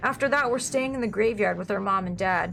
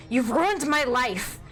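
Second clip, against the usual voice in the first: slow, higher-pitched accusing cry; mock accusation; 6 semitones higher, 4 dB louder, much slower than usual. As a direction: draw out the complaint, pitched up. Mock complaint to his wife.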